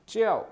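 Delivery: च्याओ said high and loud, dropping sharply; falling fourth tone. Shown as a demonstration. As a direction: snap च्याओ down from a high start. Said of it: "fourth tone है च्याओ".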